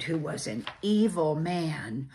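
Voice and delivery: ordinary delivery, nothing marked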